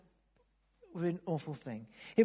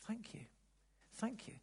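tender thanks; 4 semitones higher, 12 dB quieter than usual. Acted. Healed leper thanks Jesus.

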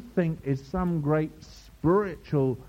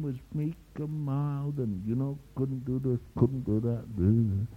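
softer, low monotone mumble; mock meek and mild; 4 semitones lower, 7 dB quieter than usual. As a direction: play it lightly meek, trailing into a soft drone. Mocks a meek man's story.

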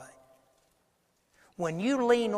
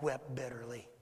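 hushed, sombre; 6 semitones lower, 11 dB quieter than usual. A narrator's reading. Sombre telling of Peter's weeping.

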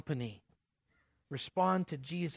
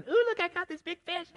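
put-on much higher, chirpy child's voice bragging; excited little kid. Pastor imitating a kid who caught a big fish, high and thrilled. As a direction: squeal it like a thrilled kid with his catch. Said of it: "little kid throws their line in".

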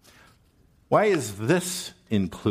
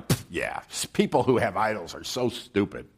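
smug scoffing listener; 3 semitones lower, 3 dB quieter than usual. Retold or imitated. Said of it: "Now you go".

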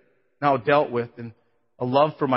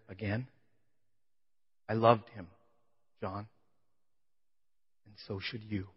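much softer, firm and gentle; mother's soft reply; 2 semitones lower, 9 dB quieter than usual. Retold or imitated. Mother at the grave answering her son, much softer and firm. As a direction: answer him softly but firmly. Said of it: "She talks pretty soft when she wants to".

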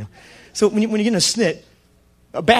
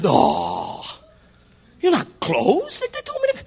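mock disbelief; 6 semitones higher, much slower than usual. Preacher replaying his stunned reply to the clerk. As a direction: act out being stunned at the counter.

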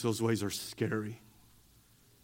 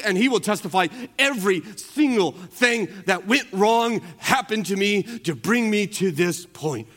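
raised, ringing pulpit voice; preaching at full force; 7 semitones higher, 6 dB louder than usual. Acted. Pastor preaching to the congregation about missionaries, full force. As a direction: preach it out to the whole room.

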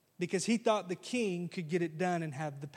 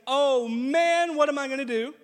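"Oh man" blurted out loud and high in despair; a king throwing up his hands, loud and higher; desperate cry; 4 semitones higher, 7 dB louder than usual. Acted. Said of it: "He's desperate".

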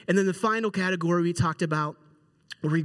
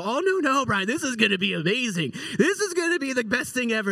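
high-pitched eager reassurance; acted boyish enthusiasm, pitch jumping up; excited kid; 5 semitones higher than usual. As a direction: imitate a thrilled teenager overruling doubts. Brothers eagerly overrule his doubts.

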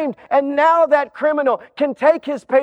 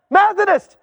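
examiner rapid-fire; 7 semitones higher than usual. Acted. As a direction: fire off the next label. Examiner questions the man.

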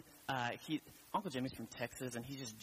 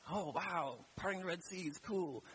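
higher-pitched, impressed reader; mock wowed; 6 semitones higher than usual. New-year Bible reader reaching Exodus, mock wowed. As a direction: marvel lightly, pitched up.

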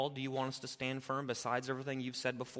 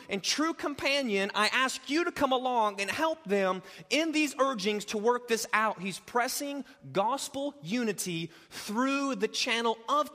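loud, high sermon climax; preaching at full force; 8 semitones higher, 8 dB louder than usual. Acted. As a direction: drive it home from the pulpit.

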